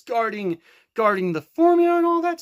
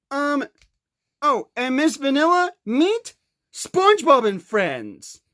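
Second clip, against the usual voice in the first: ordinary talk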